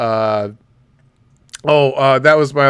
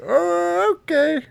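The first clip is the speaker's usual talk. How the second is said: mock surprise; 4 dB quieter, slower than usual.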